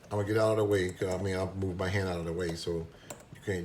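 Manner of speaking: ordinary delivery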